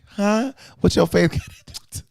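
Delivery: high, fast disbelief; what are you talking about; incredulous. Retold or imitated. Mock outrage again.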